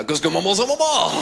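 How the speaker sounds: French-sounding gibberish, rising high on the last maman; mock French babble; fake French noises. Mocking French speech overheard on the train in a French accent. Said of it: "I'd just make French noises".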